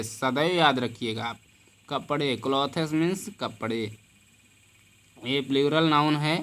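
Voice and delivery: normal talking voice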